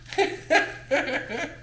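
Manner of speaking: high-pitched giggle; laughing. Real, not acted. Owner joking about life with huskies, high giggle. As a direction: giggle at your own joke.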